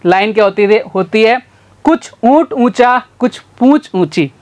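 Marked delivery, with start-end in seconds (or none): 1.8-4.3 careful deliberate tongue twister; slow tongue twister. Shown as a demonstration. Teacher slowly demonstrates the camel tongue twister. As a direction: lay out each word slowly. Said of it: "जल्दी जल्दी पांच बार बोलो".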